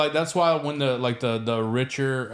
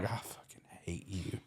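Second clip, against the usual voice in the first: breathless unvoiced curse; whispered hate; 6 semitones lower, 17 dB quieter than usual. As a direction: hiss it under your breath. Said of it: "And you're like".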